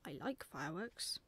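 low fatherly voice with a rise at the end; murmured approval from a waking dad; just-woken father. A narrator's reading. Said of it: "who'd just woken up".

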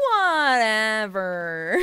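drawn-out, very loud, slow "Whatever"; loud, slow sulky drawl; bored kid. Retold or imitated. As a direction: drag it out like an unimpressed kid. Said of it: "And this little kid goes".

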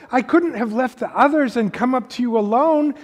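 put-on a guilty child begging not to be blamed; young Lucy, pleading. A narrator's reading. Reading Lucy's plea to the lion, high and anxious.

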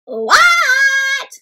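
high, long yelled reply; crying back. A narrator's reading. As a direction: shout back to Mom, stretched out. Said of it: "cried Froggy back".

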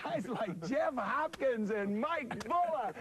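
high, slow, chuckling list; laughing. Real, not acted. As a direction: laugh through the names, pitched high. Coach joking, laughing through names.

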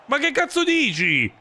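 sudden high indignant outburst; outraged disbelief. Real, not acted. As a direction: yell at the ref in disbelief. Outraged at a referee call. (sbotto incredulo)

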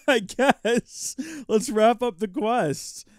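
laughing. Real, not acted. Amused after laugh.

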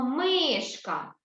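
"мишка" with a long, drawn-out МИ; stress on first syllable. Shown as a demonstration. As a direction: lean slowly on МИ in "мишка". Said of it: "На перший, так, ось, на склад ми". («мишка» з протяжним наголосом на МИ)

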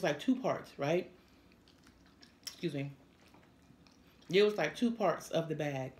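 talking while chewing food; words muffled by food; mouth full, chewing. Real, not acted. Seen 4: Woman in maroon has puffed cheeks and chews between phrases while talking.

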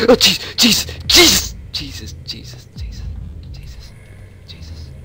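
frightened outburst fading to a whisper; panicked then hushed. Real, not acted. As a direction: cry out, then drop to a scared hush. Jump scare in empty room.

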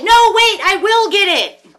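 high loud insistent cry, slowing on the end; hammed-up shrill self-correction; correcting herself. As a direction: shout the correction to undo the doubt. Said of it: "turn around and say".

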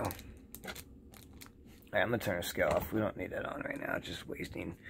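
fast, soft whispered aside; quick whisper. Real, not acted. Turning the scale off, quick whispered aside. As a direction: rush it under your breath.

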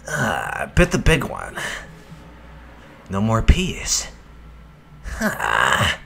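low lizard-like growl, barely voiced; a sly sticky chameleon, deep and slow; deep snake voice. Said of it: "I kinda sound like a fucking lizard".

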